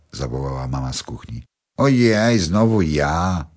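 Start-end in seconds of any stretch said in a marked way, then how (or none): 1.8-3.5 high sigh then slow whine; complaining. A narrator's reading. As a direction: grumble as if unfairly picked again. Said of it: "narzekała Paulina". (Paulina narzeka przy nakrywaniu, przeciągle)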